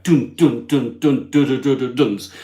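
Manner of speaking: punchy imitated drumbeats; EastEnders cliffhanger drums. Host mocking an EastEnders cliffhanger, imitating the drums. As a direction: drum out a soap cliffhanger with your voice. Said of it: "No more EastEnders kind of cliffhanger".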